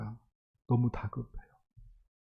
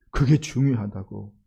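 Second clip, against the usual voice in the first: normal talking voice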